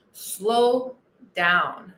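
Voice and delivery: "Slow" drawn out, pause, then "down" low; stretched slow down. Shown as a demonstration. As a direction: act out the slowing with a long gap between the words.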